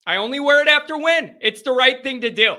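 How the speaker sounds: insistent repeat. Real, not acted. Host defending his chain rule to viewer Eric, insistent. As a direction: raise the pitch and insist on it briskly.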